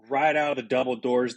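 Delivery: ordinary talk, nothing marked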